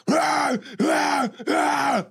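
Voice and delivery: high, loud anguished cries; imitated screams of terror. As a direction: scream like someone being tortured. Voicing tortured enslaved person.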